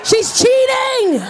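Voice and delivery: high, loud, drawn-out disbelief; escalating comic indignation, stretched words; outraged shouting. Acted. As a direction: wail that you've been cheated. Outraged at child's cheating in game.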